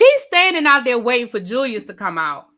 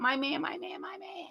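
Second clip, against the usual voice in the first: high, soft, cooing swoon; 6 semitones higher, 13 dB quieter than usual. Retold or imitated. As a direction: coo it like a smitten girlfriend. Mimicking a lovesick woman.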